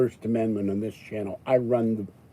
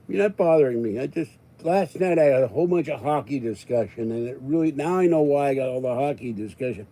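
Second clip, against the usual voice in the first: usual speaking voice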